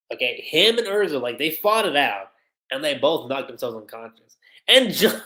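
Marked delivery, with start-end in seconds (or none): none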